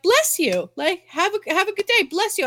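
high-pitched mimicry of sweet well-wishers; friendly strangers. Southern strangers to someone who sneezed, imitated high and sugary. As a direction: imitate over-nice passers-by, pitched well up. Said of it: "so many people were like".

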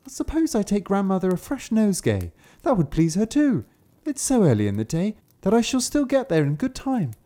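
put-on high, bright little girl. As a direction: think aloud like a cheerful child. Kapturek myśli o bukiecie.

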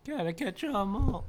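Pokemon theme voice. Pretend. Quoting the Pokemon slogan.

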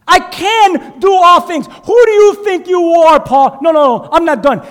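loud, rising, stretched preacher delivery; preaching at full force. Acted. Pastor drives the verse at his church, loud and slow. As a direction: build it up and hammer the verse home.

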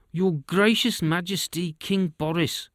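butter up the king, slow and high; oily flattering courtier. A narrator's reading.

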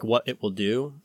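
ordinary delivery

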